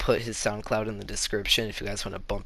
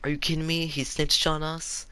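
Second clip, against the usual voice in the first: mock outrage, higher-pitched; indignant, incredulous questions pitched up high; retold angry disbelief; 6 semitones higher than usual. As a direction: imitate yourself flipping out, without shouting. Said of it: "I was flipping out, I was so angry".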